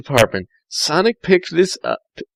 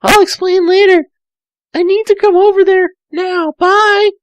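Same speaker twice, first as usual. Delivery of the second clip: put-on high, fast girlish voice; Tails, sweet and eager; young girl Tails; 4 dB louder than usual. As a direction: chirp it quickly like a young fox girl. Tails on the phone.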